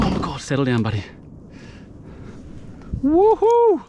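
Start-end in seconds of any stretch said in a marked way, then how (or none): 3.0-3.8 loud, high whooping cheer; delighted woohoo; joyful woohoo. Real, not acted. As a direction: cheer the catch at full voice. Celebrating the catch.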